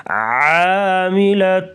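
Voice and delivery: عاملۃ slow, the ع pushed from the throat; throaty ain. Shown as a demonstration. Teacher demonstrating a throaty ع. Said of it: "گلے سے آواز نکالیں گے".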